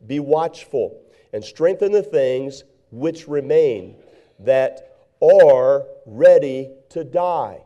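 slow, loud, drawn-out verse; scripture reading. Acted. Preacher reading Revelation to the church, slow and loud. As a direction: intone the verse with rising weight. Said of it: "Revelation chapter three ... it says".

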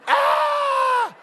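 very high, loud falling shriek; squealing scream. Retold or imitated. Tommy jumping off the cliff, acted.

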